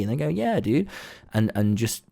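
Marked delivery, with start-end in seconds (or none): 0.3-0.9 high, bright-voiced "Yeah, dude"; high excited agreement; eager agreement. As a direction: chirp it back eagerly. Stranger agreeing happily.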